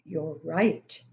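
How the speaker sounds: usual speaking voice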